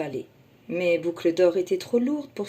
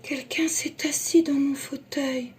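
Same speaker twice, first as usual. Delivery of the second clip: whispered gentle Mama Bear; Mama Bear to her family, soft whisper; 7 semitones higher than usual. Acted. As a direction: hush it tenderly. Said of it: "de sa voix douce".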